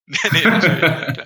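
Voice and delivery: laughing while agreeing; giggling through the words. Real, not acted. Laughing at a joke.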